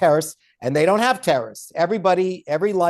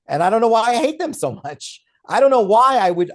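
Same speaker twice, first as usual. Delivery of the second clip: mock bigot; 6 semitones higher, 5 dB louder than usual. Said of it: "voicing a confused hater".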